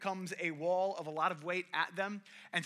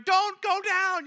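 high, loud, slow warning yell; 13 semitones higher, 10 dB louder, much slower than usual. Retold or imitated. David at the hilltop yelling to the sledders, high and loud. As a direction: shout a warning down the hill. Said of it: "going, yelling at these people".

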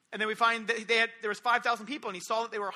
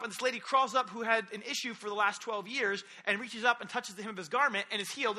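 usual speaking voice

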